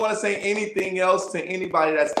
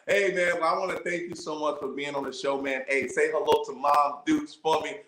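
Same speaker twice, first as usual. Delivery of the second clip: ordinary talk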